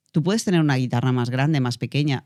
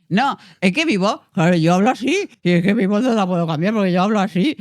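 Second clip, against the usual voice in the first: higher, louder mock of a stubborn student insisting; imitating a student; 7 semitones higher, 6 dB louder than usual. Coach mimics a student who insists she can't change her voice, higher and louder. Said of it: "cuando me dice".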